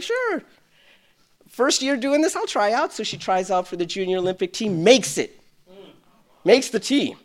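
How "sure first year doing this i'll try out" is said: put-on higher, bright-eyed teen answer; lightly voicing the girl's easy yes; a hint of an eager girl. Girl answering the committee members, lightly voiced teen. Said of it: "She's like".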